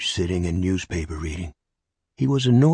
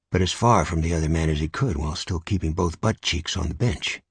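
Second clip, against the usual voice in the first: ordinary delivery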